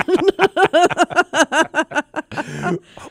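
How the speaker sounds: high loud laugh; burst of laughter. Real, not acted. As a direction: crack up laughing. Breaks up mid voice.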